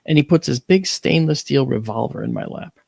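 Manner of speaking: ordinary talk, nothing marked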